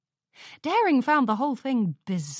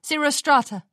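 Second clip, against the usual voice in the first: higher, louder cut-in; interrupting; 6 semitones higher, 5 dB louder than usual. Acted. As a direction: cut him off sharply. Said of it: "Daring interrupted".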